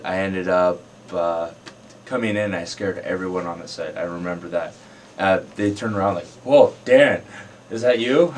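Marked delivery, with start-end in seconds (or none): 6.4-8.3 loud, higher-pitched double take; startled recognition. Retold or imitated. Crew seeing his shaved head, startled. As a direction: imitate the stunned crew, louder and higher.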